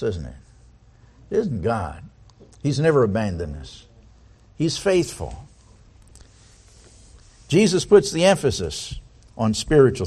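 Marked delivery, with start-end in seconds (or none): none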